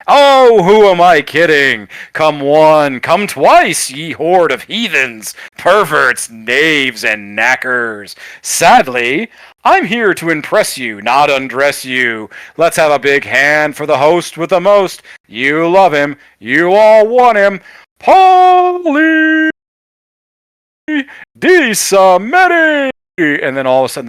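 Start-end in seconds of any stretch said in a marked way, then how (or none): 0.1-16.2 big theatrical emcee voice, raised pitch, stretched phrase endings; hammed-up announcer. Announcer heckling the crowd before introducing the host, hammed-up and projected. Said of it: "As the announcer continues". 16.4-22.9 building to a high, drawn-out shout; ring announcer's introduction. Acted. Announcer presenting the host to the crowd, slow escalating showman's yell. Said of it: "As the announcer continues".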